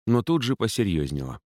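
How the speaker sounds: ordinary delivery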